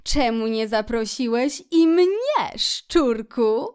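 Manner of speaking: loud, animated otter voice; Otter, hearty reproach. A narrator's reading. Otter asks Rat why she wasn't invited, playfully reproachful. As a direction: demand an answer from a friend.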